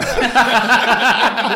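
laughing. Real, not acted. Laughing about brother.

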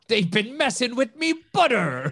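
put-on gruff old sea captain accent, laughing; a salty sailor's grumble through laughter; pirate sailor voice. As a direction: growl it like Captain Haddock. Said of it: "Why do they all sound like ... Captain Haddock".

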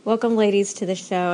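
ordinary talk

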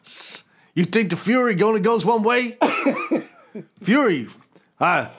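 put-on swagger voice; a knowing, leering boast; Fury answering about Hawkeye, put-on voice; Fury voice like Tropic Thunder. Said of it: "sounding very much like Iron Man there".

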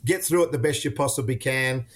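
usual speaking voice

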